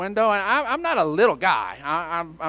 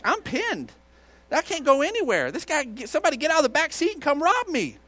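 high-pitched, rushed worry; mock panic; 8 semitones higher than usual. Pastor pinned against a stranger's car, mock panic. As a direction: act out mounting alarm, voice pitched up. Imagines being robbed while pinned.

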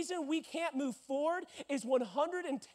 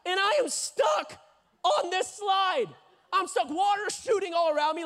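exasperated outcry; 7 semitones higher, 5 dB louder than usual. Retold or imitated. Climax, stuck on the slide.

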